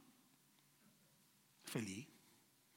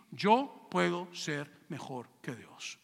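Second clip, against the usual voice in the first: slow drawn-out boast; 5 semitones lower, much slower than usual. Acted. Preacher slowly savoring Satan's boast against God, drawn out. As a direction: let every word of "Yo puedo ser mejor que Dios" linger as a slow, proud boast.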